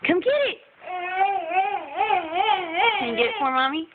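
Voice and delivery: high, sing-song coaxing; coaxing mom voice. Real, not acted. Coaxing toddler to come.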